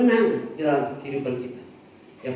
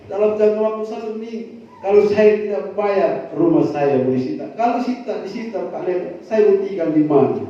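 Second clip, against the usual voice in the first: panicked plea; 4 semitones higher, 7 dB louder than usual. Retold or imitated. Woman's panicked plea retold loudly.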